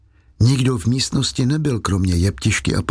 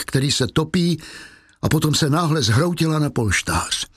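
ordinary delivery

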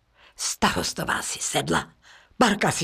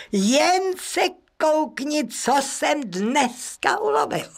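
chlubivý starosta; 9 semitones higher than usual. Acted. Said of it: "a povídá ženě".

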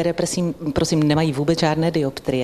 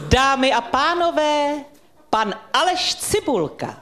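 slow, ceremonious introduction, higher and drawn out; grand announcer voice; 6 semitones higher than usual. Pretend. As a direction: make his childhood dream come true with a gala entrance. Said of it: "Tak já vám ten dětský sen splním". (slavnostní uvedení, pomalu a výš)

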